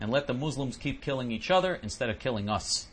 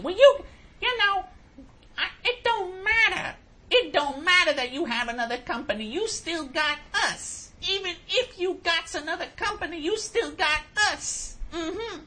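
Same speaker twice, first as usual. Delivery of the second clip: imitating the supervisor. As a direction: parody her as dismissive. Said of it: "This is the way she said".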